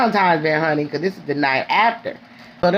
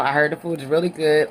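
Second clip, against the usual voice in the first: ordinary talk, nothing marked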